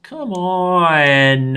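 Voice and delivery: put-on high whiny little kid voice; whining child. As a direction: whine like a three-year-old. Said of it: "I sound like my whining".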